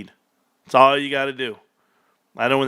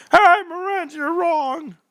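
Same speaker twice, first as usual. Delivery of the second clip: mocking chatter voice; slower than usual. Voicing a chat commenter.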